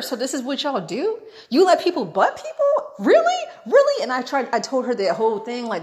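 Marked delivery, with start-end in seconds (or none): 0.0-4.0 rising questions, squeaking up to a loud really; indignant, higher and higher to the end; incredulous confrontation. Retold or imitated. Customer confronting the lady at the register, incredulous confrontation. Confronting staff over line cutting.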